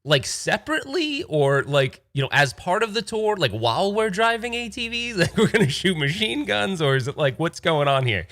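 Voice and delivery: can't believe what he's hearing; baffled caller. Retold or imitated. Comedian reacts to the machine gun offer, baffled and high. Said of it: "he's like".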